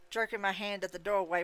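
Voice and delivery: ordinary delivery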